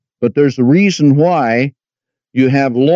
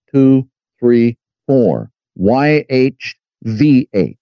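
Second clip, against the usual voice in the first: usual speaking voice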